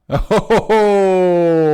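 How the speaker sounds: real loud laugh; loud burst of laughter. Real, not acted. Host caught skipping a round, laughing loudly.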